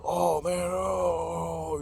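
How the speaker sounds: mock the friend's moaning complaint; hammed-up whine of self-pity; whiny moaning. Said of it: "he's bitching and moaning".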